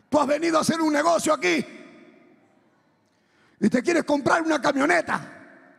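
high, loud, imploring accusation; tearful pastor. Retold or imitated. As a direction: cry it out as a pastor weeping over a sinner. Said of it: "dice el pastor así llorando".

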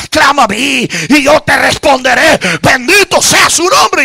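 plea rising into loud, high preaching; impassioned preacher gathering force; building preaching fervor. Real, not acted. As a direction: build steadily from urgent prayer to pulpit shout.

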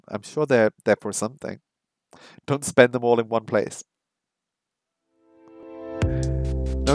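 normal talking voice

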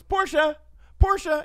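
mocking the first Ellen take; Ellen impression. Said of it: "your first one's".

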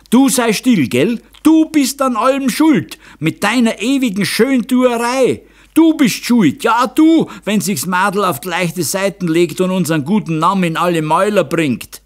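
loud, high-pitched blaming outburst; angry accusation. A narrator's reading. Father blames his wife for the daughter, angry. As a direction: turn on your wife and blame her.

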